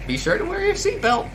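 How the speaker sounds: very high, loud tour-guide voice; proudly showcase the town in a strained falsetto; high-pitched, over-eager town greeter; Cap. Acted. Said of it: "let's see if I can do his voice".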